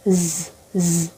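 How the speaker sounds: জ as a low quiet buzz; low, soft buzzing Z. Shown as a demonstration. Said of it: "next জি বা জেড". (নিচু নরম জ ধ্বনি)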